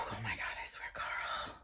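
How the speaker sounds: hushed whisper. Acted. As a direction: whisper it to Carl. Hushed aside to Carl.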